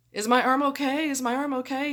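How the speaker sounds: high, loud anxious questioning; paranoid asking. Retold or imitated. Nervous patient asking the anesthesiologist about her arm, anxious and high. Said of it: "I was so paranoid".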